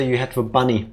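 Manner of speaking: normal talking voice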